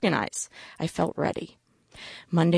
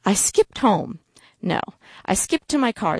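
plain reading voice

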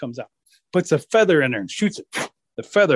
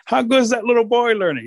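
higher, pointed grandpa question; 8 semitones higher, 4 dB louder than usual. Acted. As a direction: quiz the sons in a raised pitch. Grandpa quizzing his sons.

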